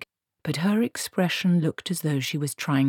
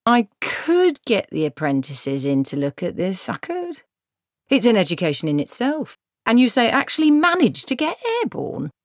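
dubious dwarf craftsman; 7 semitones higher than usual. A narrator's reading. Dwarf craftsman to Granny about her broomstick, lightly voiced and dubious. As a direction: size up the job with rising, swooping pitch.

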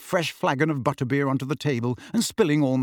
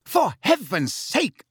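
high, drawn-out exasperated cry; screamed; 9 semitones higher, slower than usual. A narrator's reading. Missus Weasley scolding after the stew crash, screaming. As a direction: scream it slowly, word by word. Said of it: "screamed Missus Weasley".

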